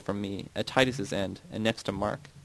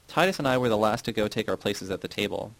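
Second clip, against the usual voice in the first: usual speaking voice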